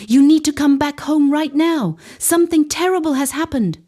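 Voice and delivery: high, loud frantic urgency; calling the sister home in a faked frenzy. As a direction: push it to panic. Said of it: "an even more frantic quality".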